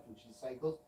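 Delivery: ordinary talk, nothing marked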